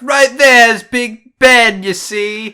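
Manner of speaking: high, loud, drawn-out British; mock British accent. Teasing friend as movie sidekick in hammed-up British. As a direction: play a British weirdo. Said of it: "they meet this British weirdo".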